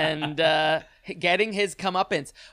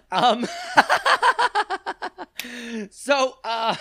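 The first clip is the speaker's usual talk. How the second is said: breaking into high giggles; trying not to laugh; slower than usual. Real, not acted. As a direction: fight back the laughter and fail. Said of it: "I'm sorry, I'm losing it".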